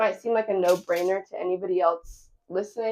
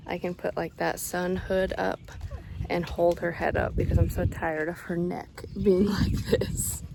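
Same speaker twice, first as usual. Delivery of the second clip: whisper. Real, not acted. Baby asleep in carrier.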